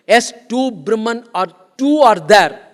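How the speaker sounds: usual speaking voice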